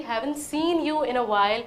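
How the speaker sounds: very slow, gentle rise at the end; drawn out for learners; slow demo. Shown as a demonstration. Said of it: "उसमें हम यही बोलते हैं कि". (धीमे, खींचकर बोला गया)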